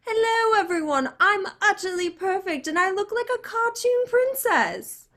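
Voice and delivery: high, posh British princess mock; mock a snooty British princess; British accent. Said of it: "Why does she still have a British accent?".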